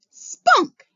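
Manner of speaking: high, loud burst of triumph; triumphant. A narrator's reading. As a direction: shout the word you finally found. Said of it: "he says triumphantly".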